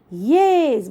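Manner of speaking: ordinary talk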